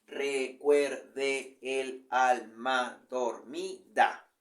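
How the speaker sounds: very slow, every syllable spaced out; very slow syllable count. Shown as a demonstration. Teacher counting the eight syllables aloud, very slowly. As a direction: spell out the meter slowly. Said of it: "Primer verso".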